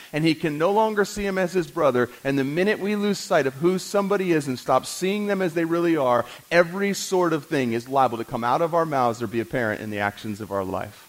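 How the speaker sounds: ordinary talk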